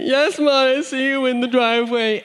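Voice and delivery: high, put-on weepy voice; hammed-up tearful relief; whimpering little child. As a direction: wail it high, like a small kid. Tearful son answering mom.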